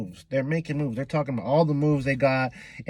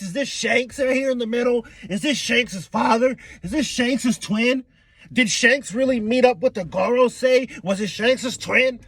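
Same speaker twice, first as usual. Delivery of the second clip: ordinary talk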